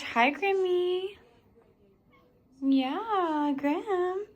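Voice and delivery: high, soft, slow coo to the dog. Real, not acted. Talking sweetly to her pet.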